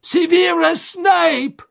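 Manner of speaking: high, loud, slow desperate cry; desperate shout. A narrator's reading. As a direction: drag the name out in a frantic yell. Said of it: "rief er".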